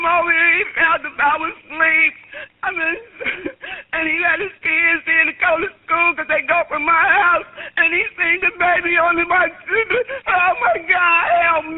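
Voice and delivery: real crying. Real, not acted. Grandmother tells the dispatcher what her son found, sobbing. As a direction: break down in real sobs while telling it. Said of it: "stop crying".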